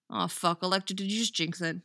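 ordinary delivery

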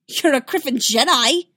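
loud, high accusation; accusing shout. A narrator's reading. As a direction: shout it at him. Hostile trooper accuses Anakin.

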